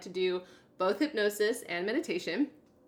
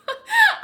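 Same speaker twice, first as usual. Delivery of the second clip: bright sudden laugh; burst of laughter; 11 dB louder than usual. Real, not acted. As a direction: laugh out loud. Amused at own routine.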